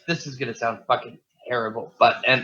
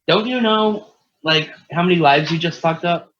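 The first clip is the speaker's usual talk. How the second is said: high, louder indignant scolding; retold anger; 6 semitones higher, 6 dB louder than usual. To his mom after her death, indignant scolding.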